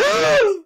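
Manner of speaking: sharp high gasp of amazement; mock gasp. Photographer seeing her aura on camera, gasping high. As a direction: gasp like he's stunned. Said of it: "he went".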